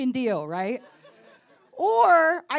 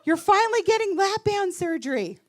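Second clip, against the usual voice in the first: high mock guesses from a crowd; retold shouting out; 6 semitones higher than usual. Party guests guessing at her announcement, mock shouting out. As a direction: imitate guests calling out excitedly, not full volume. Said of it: "People started shouting out".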